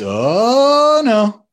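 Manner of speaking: high, loud, swooping "oh"; playful long "oh no". Retold or imitated. Dad imitating his son's game, drawn-out oh no. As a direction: stretch the "oh" up high, then drop. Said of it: "but one day he says".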